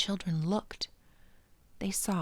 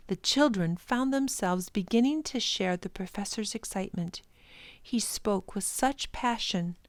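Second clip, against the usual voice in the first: usual speaking voice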